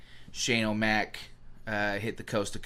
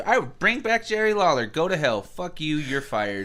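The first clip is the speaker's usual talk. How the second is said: higher, louder mock tirade; mock boss rant; 5 semitones higher, 4 dB louder than usual. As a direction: fire him like a pompous boss. Mock firing Michael Cole rant.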